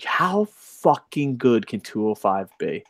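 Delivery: slow breathless whisper. Real, not acted. Fan longing for a good cruiserweight show, whispered. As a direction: hush it like a secret wish. Wistful wrestling wish.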